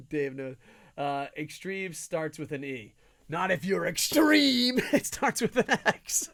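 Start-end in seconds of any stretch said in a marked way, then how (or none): 3.3-6.3 slow, high, laughing promo voice; laughing mock hype. Host joking that extreme starts with X. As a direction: crack up mid hype line.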